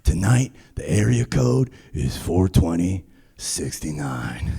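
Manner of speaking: low, soft, slow lazy voice; dopey cool singer. Retold or imitated. Comic imitating the Seattle singer teasing the crowd, low and slow. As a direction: drawl it like a stoner rock singer. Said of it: "He goes".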